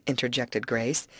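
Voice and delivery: ordinary delivery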